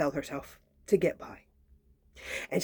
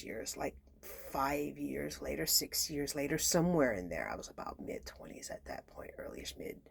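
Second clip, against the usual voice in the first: whispered aside; 5 semitones lower, 13 dB quieter than usual. Real, not acted. Whispered aside about her age.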